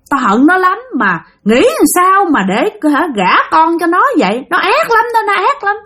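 high-pitched, resentful ghost voice; piercing voice of a wronged spirit; cô Liễu. Acted. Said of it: "chỉ nghe cái giọng thôi".

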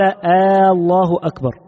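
"آه الله أكبر" with a long "آه" before "الله", slow and falling; wrong takbir demo. Shown as a demonstration. Teacher quizzing students on the wrong added vowel, slowly. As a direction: draw out "آه" as a separate vowel, then "الله أكبر". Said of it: "لو أنه قال".

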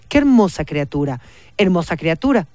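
plain reading voice

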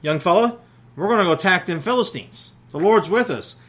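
a hint of a brave leader; bold Jonathan. Jonathan rallies his young armor bearer, boldly. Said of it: "Jonathan tells his armor bearer".

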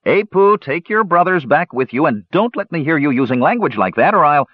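high, harried fatherly scolding; scolding parent. A narrator's reading. Warrior father to Apu over bad language, scolding. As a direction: warn the child with mounting exasperation.

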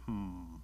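low, soft "hmm"; quiet musing hum; pondering hum. A narrator's reading. Bear ponders suspiciously.

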